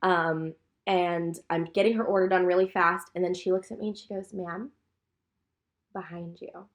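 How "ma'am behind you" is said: soft, flat, almost under her breath; deadpan hushed warning from the customer; quiet mock warning. Customer flatly telling the server about the spill, quietly. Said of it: "That's how she said it".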